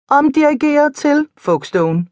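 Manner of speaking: high-pitched talking car; car voice assistant. A narrator's reading. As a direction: announce a reroute like a car's computer. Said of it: "sagde bilen".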